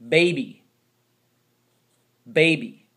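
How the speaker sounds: usual speaking voice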